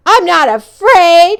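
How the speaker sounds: loud quavering boast; cried out. Acted. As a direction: cry it out to hide the fear. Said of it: "he cried".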